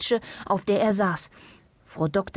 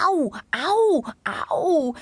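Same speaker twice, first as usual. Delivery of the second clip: pained wailing; 9 semitones higher, much slower than usual. A narrator's reading. Patient moaning to his doctor, wailing. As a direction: wail each "au" like a suffering patient. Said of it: "Herr Ombovesi jammerte sehr".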